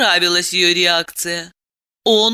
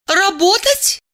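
high-pitched disbelieving woman; incredulous; 13 semitones higher than usual. Acted. Diana cannot believe her ears, incredulous. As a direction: squeak the question in disbelief. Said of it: "Она не поверила своим ушам".

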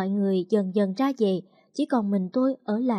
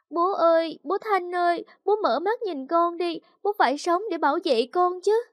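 higher, theatrical weeping plea; crying daughter; 8 semitones higher than usual. A narrator's reading. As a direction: wail to the body for show. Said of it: "Con Mẫn cũng tu tu khóc theo". (khóc lóc gọi bố, giọng cao)

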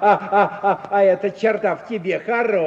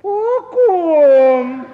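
high, loud startled ask; alarmed question; 6 semitones higher, 9 dB louder than usual. Acted. Uncle alarmed, suspicious.

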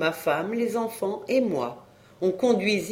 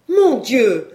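high, loud outburst; exasperated exclamation; 6 semitones higher, 9 dB louder than usual. A narrator's reading. Wife protesting at her husband's cattle talk, exasperated. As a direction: cry out in exasperation at him.

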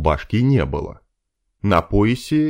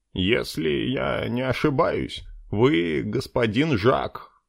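soft elf voice; 6 semitones higher, 4 dB quieter, slower than usual. Acted. Dark elf answers politely.

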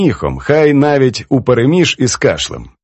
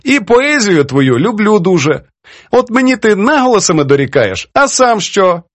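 put-on high-pitched, playful reproach from an elderly poetess; teasing old poetess; 10 semitones higher than usual. Poetess chides her young editor over his edits, teasing. Poetess praises, then challenges.

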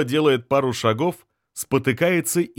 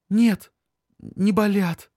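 lightly voiced high girl; 8 semitones higher, slower than usual. A narrator's reading. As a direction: answer quickly in a high girlish voice. The girl answering.

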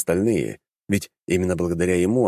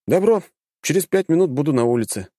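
higher, louder brisk agreement; eager young hero; 8 semitones higher, 3 dB louder than usual. A narrator's reading. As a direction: snap out a keen yes.

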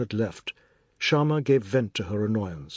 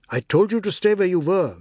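louder, sharper telling-off; angry scolding; 7 semitones higher, 6 dB louder than usual. Acted. Father scolds his son after the near drowning, angry. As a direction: scold the boy for not staying put. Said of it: "angry too".

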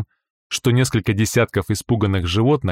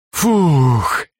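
relieved puff; 5 semitones higher, 4 dB louder than usual. Acted. Relief after hot ride.

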